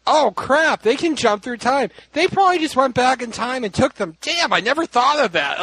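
mock dismayed realisation. Harry finding the scrolls missing, dismayed realisation. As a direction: play a sudden, exasperated realisation.

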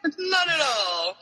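high, drawn-out sarcastic agreement. Real, not acted. Agreeing that it's not creepy at all, sarcastic. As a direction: play along with mock denial.

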